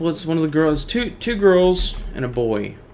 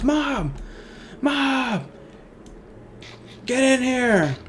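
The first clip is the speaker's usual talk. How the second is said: mock yell, much higher, calling mom from another room; son shouting; 10 semitones higher, much slower than usual. Son in the joke yelling for his mother, hammed-up panicked shout. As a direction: holler for mom like a panicked boy. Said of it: "he shouts".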